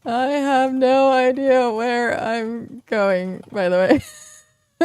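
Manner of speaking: talking through giggles; laughing. Real, not acted. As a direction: laugh while you say it. Laughing while lost on map.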